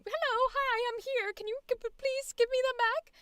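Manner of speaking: high, stammering and timid; cute timid voice. Retold or imitated. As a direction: nervously ask for them back. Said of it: "where it's like".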